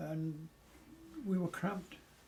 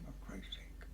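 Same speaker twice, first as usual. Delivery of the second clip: muttered dismay; 6 semitones lower, 11 dB quieter than usual. Retold or imitated. His reaction to the confession.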